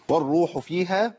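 slow "والروح فيها" with long oo and ee; recited madd. Shown as a demonstration. Teacher showing ya madd, "فيها" recited slowly. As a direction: chant "والروح فيها" slowly, lengthening the vowels. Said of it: "الياء هنا مادية".

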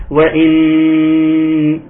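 وإن with the nun held long and nasal; doubled nun. Shown as a demonstration. Teacher showing the doubled nun, long held nasal. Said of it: "النون مشددة".

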